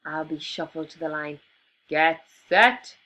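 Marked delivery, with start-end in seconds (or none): 1.9-3.0 loud shout. A narrator's reading. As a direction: call the start loudly. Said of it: "shouted the rhino".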